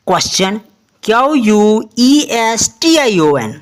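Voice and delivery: ordinary talk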